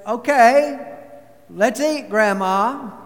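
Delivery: loud cheerful call to the table. Acted. Someone at Thanksgiving calling the family to eat, loud and cheerful. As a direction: call everyone to dinner.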